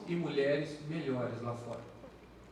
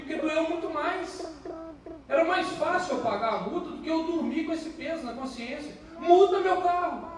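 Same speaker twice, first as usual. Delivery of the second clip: much higher, urgent and pleading; frantic pleading; 11 semitones higher than usual. Retold or imitated. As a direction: beg the officer to tow the car. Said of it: "puxei ele". (homem pedindo ao policial, aflito e agudo, para guinchar o carro)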